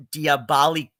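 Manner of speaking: "Diabolique" split up as dee-uh-BAH-lee-kyoo; mock a botched French word, "Diabolique" as dee-uh-bah-lee-KYOO; imitated mispronunciation. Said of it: "She pronounced Poppy's aliases".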